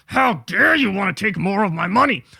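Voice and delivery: lightly voiced affronted millionaire; a hint of a miffed rich man; mock outrage. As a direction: sneer his how dare you back at him. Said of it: "He's a multi-hundred millionaire going".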